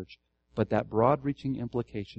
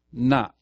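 "Not" loud, standing alone; stress on NOT; 6 dB louder than usual. Shown as a demonstration. Pastor returns to the emphasized word for the class, "Not" stressed. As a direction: punch out the single word "Not". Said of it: "the emphases here".

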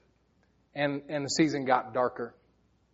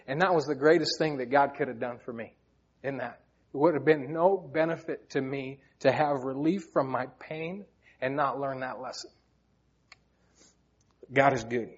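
ordinary delivery

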